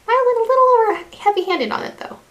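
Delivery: caught-out high exclamation; startled admission. Real, not acted. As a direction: squeak it out as you notice the mistake. Oops at heavy blush.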